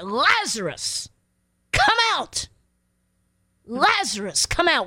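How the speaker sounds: Jesus calling Lazarus out, commanding; commanding shout. Retold or imitated. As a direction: call the dead man out of the tomb with force. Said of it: "No, he shouted".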